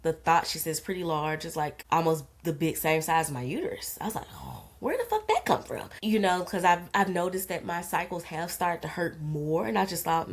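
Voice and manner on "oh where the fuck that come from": sudden high startled question; shocked outburst. Retold or imitated. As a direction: blurt it out in disbelief. Shock at cyst news.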